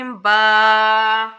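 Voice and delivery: با held long as a wrong example; stretched syllable. Shown as a demonstration. Teacher showing how not to stretch با. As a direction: drag the با out on one long note. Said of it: "آواز کو لمبا کر دینا، کھینچنا".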